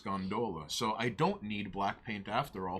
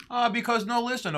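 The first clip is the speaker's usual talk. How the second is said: much higher, louder laughing insistence; half-laughing no, listen to his co-host; excited protest; 11 semitones higher, 7 dB louder than usual. Real, not acted. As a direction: cut in, giggly and pleading.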